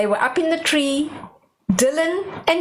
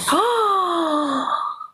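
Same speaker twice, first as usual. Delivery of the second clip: gasp; 6 semitones higher than usual. Acted. Story reader gasps at magic.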